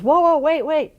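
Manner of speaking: high, loud call of "whoa, whoa, wait"; retold yell. Someone yells at the elevator doors, loud high call. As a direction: shout to stop the closing elevator. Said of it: "somebody yells".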